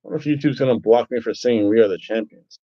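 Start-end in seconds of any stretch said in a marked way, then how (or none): 1.7-2.6 low, muttered horrible hobo voice. Pretend. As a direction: croak it like a bad hobo. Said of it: "My horrible hobo voice".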